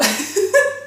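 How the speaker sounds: high, squealing laugh after a sour sip; shocked high laugh. Real, not acted. Reaction to sour taste.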